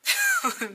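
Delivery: loud, high burst of laughter; short, high-pitched cackle; disbelieving laugh. Real, not acted.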